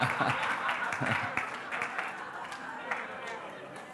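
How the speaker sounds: quiet giggle of relief; soft laugh. Real, not acted. After prayer answered.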